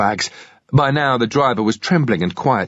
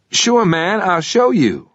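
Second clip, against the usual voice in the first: put-on 1 young driver; 9 semitones higher, slower than usual. Driver voiced in a thriller.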